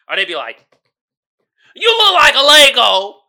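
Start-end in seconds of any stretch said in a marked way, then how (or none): 1.8-3.2 loud mocking insult. Voicing bully insult.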